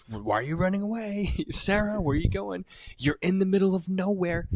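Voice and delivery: high, taunting stalker voice; creepy sing-song killer. Retold or imitated. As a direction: call after her like a cheerful killer. Voicing killer chasing her.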